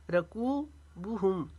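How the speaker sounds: रकूबुहुम slowly, कू held long; long कू, short बु. Shown as a demonstration. Teacher modelling madd, रकूबुहुम with long कू and short बु. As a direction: stretch only कू in रकूबुहुम. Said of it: "बू को नहीं खींचेंगे".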